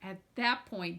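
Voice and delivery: usual speaking voice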